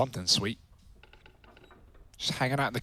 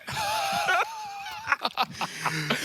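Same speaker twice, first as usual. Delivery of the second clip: squealing laughter; high wheezing laugh; 3 dB quieter than usual. Real, not acted. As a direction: laugh until it goes high and breathless. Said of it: "Stop laughing".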